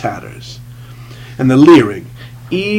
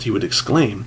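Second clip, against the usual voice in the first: plain reading voice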